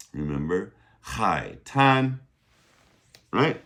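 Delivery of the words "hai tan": "Hai tan" with an airy H, second syllable high and level; aspirated H. Shown as a demonstration. Teacher reminding learners about beach, aspirated H. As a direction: model "Hai tan" clearly, puffing the H. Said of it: "That's our aspirated H".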